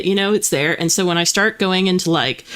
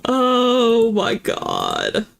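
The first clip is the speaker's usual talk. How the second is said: very slow, stretched-out groan of disbelief; drawn-out exasperation; 3 semitones higher, much slower than usual. Real, not acted. Streamer reacting to the Doom spin, long exasperated drawl. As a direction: drag it out in playful despair.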